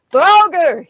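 loud, high shout of warning; shouted alarm. A narrator's reading. Fighter naming the monsters to his company, shouted. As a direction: yell the monster's name out. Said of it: "he, he shouted".

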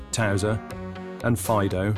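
usual speaking voice

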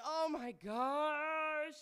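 high, loud, long drawn-out cry; dismayed wail. Retold or imitated. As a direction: stretch it out into a slow, dramatic wail. Re-enacts own dismay at mom.

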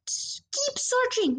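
high, stretched-out call; excited shout. A narrator's reading. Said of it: "my father shouted".

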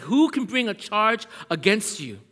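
preacher rallying the church loudly; preaching climax. Real, not acted. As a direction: build to a shout of triumph.

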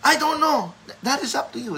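put-on very high child voice, uncertain answer; high-pitched mock-child reply; young son. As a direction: imitate a boy shrugging off a question. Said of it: "And then my son looked".

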